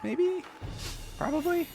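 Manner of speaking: high, slow, questioning lilt; doubtful guess. Real, not acted. Viewer guessing who wins the fight, high and doubtful. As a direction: climb very high into an unsure question.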